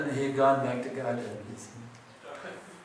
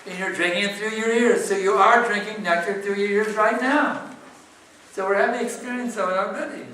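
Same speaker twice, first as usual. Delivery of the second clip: higher, louder triumphant argument; emphatic insistence; 6 semitones higher, 5 dB louder than usual. Real, not acted. Teacher proving to the questioner they have experience, insistent. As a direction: hammer the conclusion home, pitch raised.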